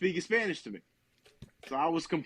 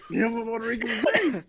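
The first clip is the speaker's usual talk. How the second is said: laughing hard; 6 semitones higher, 4 dB louder than usual. Real, not acted. Cracking up at the joke.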